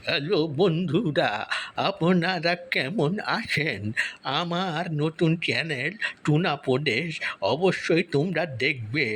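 slow drawn-out syllables, wavering pitch; slow, sing-song disguised voice; put-on voice. Shown as a demonstration. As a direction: stretch each word in a wobbly put-on voice. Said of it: "আমি নিজে আপনাদেরকে একটা শোনাচ্ছি".

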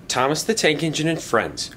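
ordinary delivery

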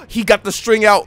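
sharp gasp, then loud words; gasping surprise. Real, not acted. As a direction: blurt it out after a gasp. Gasp at fight scene.

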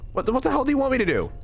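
high, fast indignant question; rapid high-pitched disbelief; exasperated protest. Real, not acted. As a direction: demand an answer in baffled exasperation. Exasperated at pronunciation criticism.

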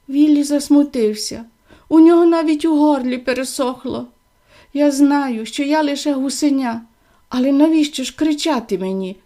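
ordinary delivery

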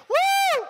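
very high, loud woo; imitated joyride whoop; excited whoop. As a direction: let out a piercing mock whoop. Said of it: "you're not like".

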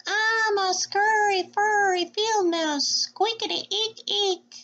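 high, slow little mouse singsong; tiny shy mouse squeaking; squeaky mouse voice. Acted. Teacher voicing the field mouse for kids, high and squeaky.

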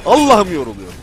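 loud panicked cries; desperate wailing. Real, not acted. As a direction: complain while fleeing. Running and lost.

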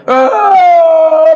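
high, loud, long-held cry; imitated wail. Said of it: "teriak lah". (tangisan meraung yang ditiru, tinggi dan panjang)

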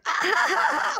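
high mock squeals from next door; imitated game noises. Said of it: "I hear".

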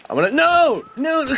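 strangled cry of no as he dies; dying noise. Real, not acted. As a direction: yelp out a dying no. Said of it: "That's my dying noise".

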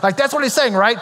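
ordinary delivery

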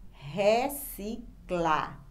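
"reciclar" very slowly as re, ci, clar; read with pauses. Shown as a demonstration. Teacher asking for a paced reading, syllable by syllable. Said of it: "Leia agora pausadamente a palavra".

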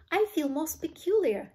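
alarmed, gasped-out complaint; mild gasp. A narrator's reading. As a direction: exclaim in unease. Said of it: "gasped Violet".

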